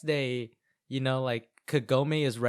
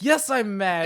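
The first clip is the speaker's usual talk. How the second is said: mock outburst, high and loud; exploding; 6 semitones higher, 7 dB louder than usual. Kagome finally explodes at Inuyasha, acted angry outburst. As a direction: blow up in pretend anger. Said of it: "And then she explodes".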